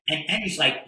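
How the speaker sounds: ordinary delivery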